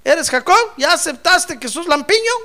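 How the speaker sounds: loud, high, insistent questioning; God demanding an answer. Acted. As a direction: press him loudly, question after question. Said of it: "le dijo".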